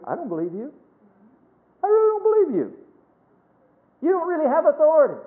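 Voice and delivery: mock defiant child. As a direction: play the kid who calls the bluff. Voices the child defying the parent.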